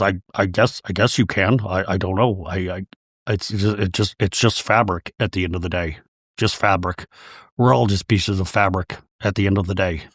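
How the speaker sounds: low, halting put-on man's voice; gruff flustered husband. Voicing Todd, gruff and at a loss.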